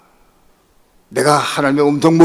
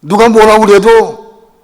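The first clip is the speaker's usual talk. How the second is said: fervent vow; 3 semitones higher, 10 dB louder than usual. Retold or imitated. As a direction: cry out a vow of surrender. Vow in prayer. (목 놓아 외치는 서원)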